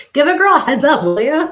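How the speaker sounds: loud, high, laughing complaint to the spirit; playful outburst. Real, not acted. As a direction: call it out, mock indignant.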